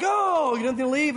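high, loud put-on "oh"; hammed-up cry, starting high and easing down; play-acting. Acting for the person who greeted the pastor, hammed-up. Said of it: "you kinda act like".

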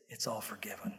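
low, tender near-whisper; very soft and low, full of reverence; hushed absolution. Acted. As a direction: whisper it as God granting pardon. Voicing God granting forgiveness.

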